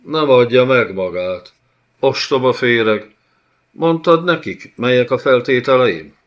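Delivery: loud, high, raging rebuke; furious lord. Acted. Giant lord roars at the envoy, furious. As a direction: spit the insult at the worm.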